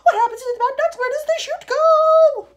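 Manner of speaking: high, loud, frantic shriek. A narrator's reading. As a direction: shriek the questions, drawing out the end. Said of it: "shrieked Missus Salt".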